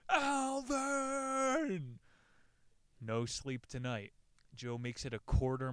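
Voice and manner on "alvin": desperate high shout into the night; a lost climber screaming for his friend in the dark; shouting for help. Acted. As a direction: yell out for help, much higher and louder. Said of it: "Joe shouts into the night".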